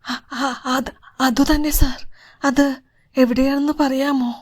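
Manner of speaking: shaking with emotion, pleading to know; overcome with emotion. A narrator's reading. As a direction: stammer it out, close to crying. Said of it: "വികാരഭരിതനായി ശരീരം വിറച്ചു".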